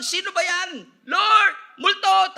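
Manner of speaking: high, loud, drawn-out cry; acted scream of alarm; alarmed shouting. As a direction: shout it like terrified men at sea. Disciples mistaking Jesus for a ghost.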